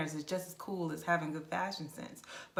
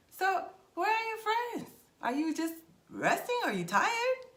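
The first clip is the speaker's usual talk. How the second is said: high mock man's voice; 12 semitones higher, 3 dB louder than usual. Roman man chatting up.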